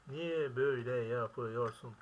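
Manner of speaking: slow, drawn-out exasperated repeat. Real, not acted. Gamer repeating his plea to the game, slowly drawn out. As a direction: stretch each word out in despair.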